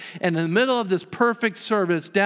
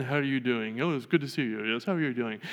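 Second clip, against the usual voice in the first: polite guest chatter; 5 semitones lower, 5 dB quieter than usual. Acted. Party guests greeting each other.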